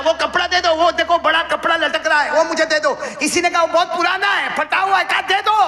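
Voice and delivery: high, loud preaching climax; full-force preaching. Acted. Preacher pushing the crowd toward the climax, high and loud. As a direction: drive the story up high and loud.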